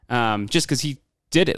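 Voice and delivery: usual speaking voice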